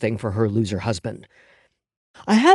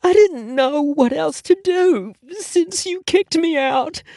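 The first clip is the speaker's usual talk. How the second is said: high, slow tearful woman; slower than usual. Acted. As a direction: plead tearfully, slowly. Nadine's tearful voice.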